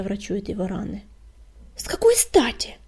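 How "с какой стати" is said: high, loud, indignant yell; indignant cry. A narrator's reading. She objects to healing the enemy, crying out. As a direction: cry out in sharp protest. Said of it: "крикнула Кисточка".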